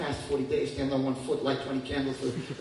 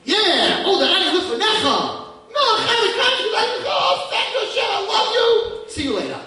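high, loud, laughing cheer; cheery usual prayer; 10 dB louder, slower than usual. Pretend. Girl's everyday joyful morning prayer, loud and high. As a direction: greet God like a dear friend. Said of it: "your regular getting up in the morning".

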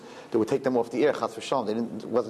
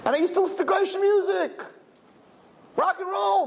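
mock confession. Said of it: "recalling his youth".

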